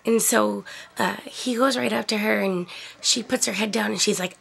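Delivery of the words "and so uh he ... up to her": usual speaking voice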